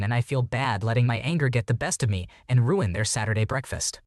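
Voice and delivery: ordinary delivery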